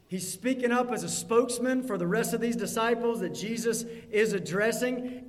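usual speaking voice

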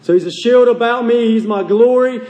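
ordinary delivery

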